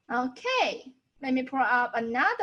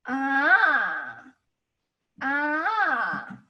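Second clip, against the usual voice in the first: long "Ah" with a turning point in its pitch; third tone. Shown as a demonstration. Teacher moves body to show the tone, long bending "Ah". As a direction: stretch "Ah" and bend it like a wave. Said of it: "So it goes down and then up".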